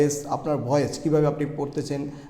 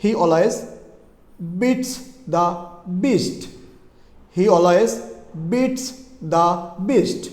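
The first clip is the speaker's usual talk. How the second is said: word by word, "beats" and "beast" pitched up; slow practice sentence; much slower than usual. Shown as a demonstration. Teacher gives a B practice sentence, slow and separated. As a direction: pause before each b word, deliberate. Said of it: "sentence যদি বলি তাহলে".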